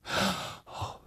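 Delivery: breathless unvoiced oh; a sharp frightened gasp. A narrator's reading. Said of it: "said Lolka".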